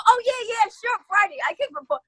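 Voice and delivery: high, eager gush to the bartender; eager yes. Retold or imitated. Agreeing to perform Friday, high and eager. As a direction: jump at the chance, voice way up.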